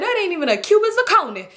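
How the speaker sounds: high-pitched mocking uncle; hammed-up derision; imitated ridicule. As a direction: scoff in a put-on high voice. Acts out uncle ridiculing ring.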